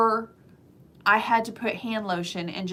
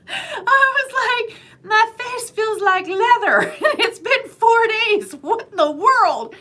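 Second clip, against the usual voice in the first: laughing; 13 semitones higher, 7 dB louder, slower than usual. Real, not acted. Laughing about leather-dry face.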